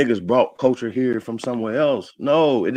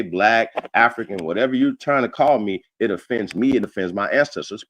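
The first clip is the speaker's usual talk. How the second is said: ordinary talk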